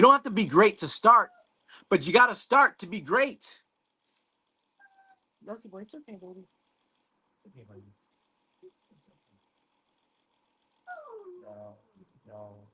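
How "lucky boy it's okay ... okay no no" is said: hushed, gentle soothing; calm the frightened dog softly; soothing a scared dog. Real, not acted. Calming his scared dog.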